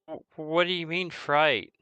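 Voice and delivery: put-on a hesitant, puzzled kid; timid orphan child. Orphan questioned by visitors about a fright, timid. Game master voices orphan Jeske.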